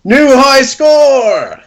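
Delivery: high, excited hype-man call; hype man shout. Acted. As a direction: cheer it like a game hype man. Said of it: "my hype man".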